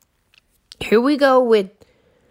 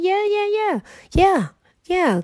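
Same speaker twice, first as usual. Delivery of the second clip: mock agreement; 8 semitones higher than usual.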